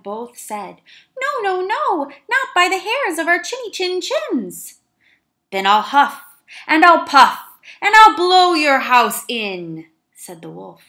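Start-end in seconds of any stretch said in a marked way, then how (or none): 1.2-4.8 high, loud squeaky refusal; two tiny goats shouting no together; high little goats defying the wolf. Acted. 5.5-9.9 very loud, slow wolf roar; big bad wolf threatening; wolf threat.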